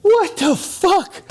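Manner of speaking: very high, slow stretched squeal; drawn-out disbelief. Retold or imitated. On seeing his friend in the fog, high drawn-out disbelief. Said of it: "and I'm like".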